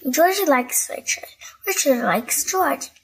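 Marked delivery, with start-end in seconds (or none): none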